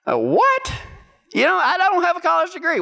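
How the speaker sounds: high-pitched, baffled disbelief; incredulous. Retold or imitated. Applicant offered a third interview, incredulous. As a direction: shoot up high in astonishment.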